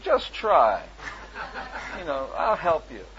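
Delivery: coaxing with a laugh in the voice. Real, not acted. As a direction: encourage through laughter.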